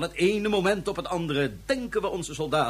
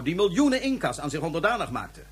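usual speaking voice

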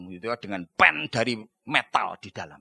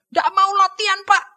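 complaining nurse; 10 dB louder than usual. Retold or imitated. Nurse reporting to doctor.

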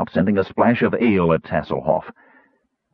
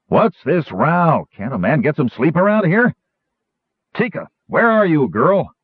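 higher-pitched, aggrieved complaint; cross innkeeper; 8 semitones higher than usual. A narrator's reading. Woken innkeeper looking for the barmaid, cross. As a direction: call out crossly for the culprit.

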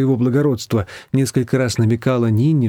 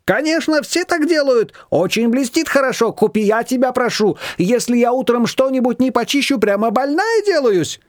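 higher, insistent pleading voice; fussy neighbour; 11 semitones higher than usual. A narrator's reading. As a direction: beg Nina to buy it. Shushana pleads.

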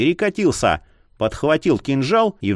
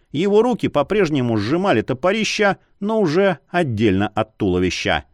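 ordinary delivery, nothing marked

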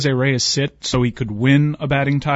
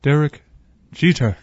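Bob Shepard impression; 3 semitones higher than usual. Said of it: "It's kind of haunting".

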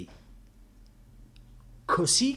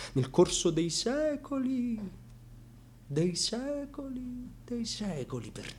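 drawn out; 10 semitones higher, 5 dB quieter, slower than usual. Acted. Dramatic repetition, drawn out.

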